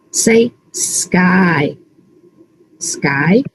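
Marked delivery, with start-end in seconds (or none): none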